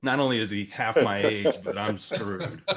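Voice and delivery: loud, laughing self-mockery; loud amused aside about being outmatched; laughing through it. Real, not acted. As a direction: laugh at your own bad odds as you tell it. Laughing at his own odds.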